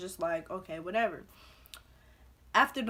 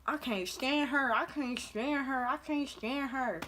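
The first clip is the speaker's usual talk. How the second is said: slow, high, slurred mock of talking through food; smacking mouth-full imitation; 5 semitones higher than usual. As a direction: imitate a girl chewing as she complains. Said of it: "she's just smacking".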